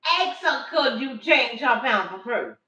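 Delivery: high loud, slow insistence; loud slow lecture; loud order. Retold or imitated. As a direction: command her daughter to ask. Grandma ordering daughter.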